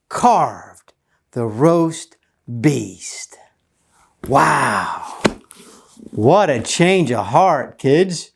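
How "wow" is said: ordinary delivery, nothing marked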